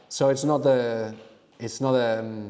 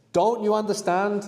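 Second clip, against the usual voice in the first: "understand" high and rising at the end; question tune; 8 semitones higher than usual. Shown as a demonstration. Teacher giving the question in mind, high rising "understand". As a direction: ask it, voice lifting through "understand". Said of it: "that sounded like a question".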